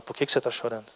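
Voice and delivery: very soft, low-pitched question; tender and quiet; gentle questioning. Acted. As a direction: press gently, almost in a hush. God keeps gently asking.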